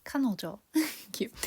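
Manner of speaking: hushed repeat then a giggle; whispered repeat. Real, not acted.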